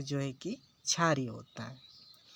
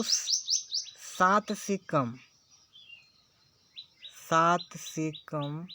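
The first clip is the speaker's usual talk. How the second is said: slow, deliberate teacher stress on सात से कम; slow emphasis; much slower than usual. Shown as a demonstration. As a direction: spell out सात से कम slowly so it sinks in. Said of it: "teacher stresses key rule". (धीमे, ज़ोर देकर)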